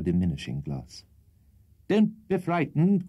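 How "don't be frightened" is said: high, drawn-out call. A narrator's reading. Old host to his alarmed daughters, calling out. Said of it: "called out the old host".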